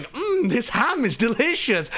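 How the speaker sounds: put-on high, relishing Latvian-accented mumble of delight; Miralyn's Latvian accent. Latvian guest eating raw bacon, high accented delight. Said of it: "this high tone and in this amazing accent".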